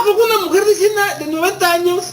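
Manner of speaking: high-pitched mock disbelief; incredulous question. Preacher doubts a woman of ninety bearing a child, incredulous. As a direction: ask it way up high, as a doubter would. Voicing doubt about aged mother.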